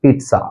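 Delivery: पिज़्ज़ा with a T, peet-sa; correct pronunciation. Shown as a demonstration. Teacher modelling the actual word, पिज़्ज़ा as peet-sa. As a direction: put a crisp "ts" inside पिज़्ज़ा. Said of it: "इसका जो actual pronunciation है".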